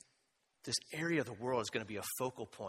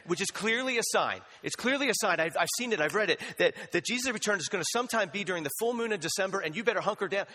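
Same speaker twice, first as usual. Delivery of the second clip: higher, louder hammed-up alarm about signs; mock doomsayer; 9 semitones higher, 7 dB louder than usual. Preacher lampooning the ditch on the right, laughing mock alarm. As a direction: laugh through a parody of an end-times prophet.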